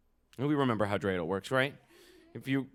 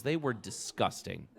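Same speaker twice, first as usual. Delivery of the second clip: usual speaking voice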